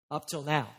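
low, sober echo after a long pause; ominous, dropped-pitch repeat; grave echo. Real, not acted. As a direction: hand the excuse back as a warning. Preacher echoes excuse as warning.

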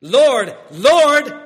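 loud, rising desperate cries of Lord; desperate repeated Lord. Retold or imitated. Sinners at judgment begging Jesus, loud desperate cries. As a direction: pile up the Lords, begging harder each time. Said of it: "they add it in".

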